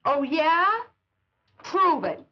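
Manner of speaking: taunting. Acted. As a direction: dare him, scornful. Taunting challenge in a quarrel.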